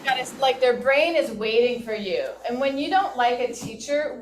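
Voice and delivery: ordinary talk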